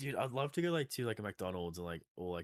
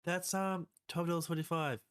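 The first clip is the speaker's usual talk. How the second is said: mock cashier voice; 10 semitones higher, 4 dB louder, slower than usual. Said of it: "she's like".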